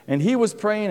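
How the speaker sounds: ordinary talk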